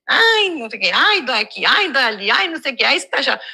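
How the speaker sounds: sing-song litany of little moans; put-on whining, each "ai" swooping down; mock complaining. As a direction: imitate a habitual grumbler.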